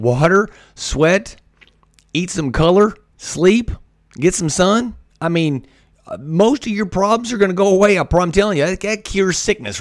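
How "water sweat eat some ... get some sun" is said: each item lifted with a rising end; emphatic list. Shown as a demonstration. Recapping his health rules, emphatic and rising. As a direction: tick off each item with a lift. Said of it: "Let me say that again".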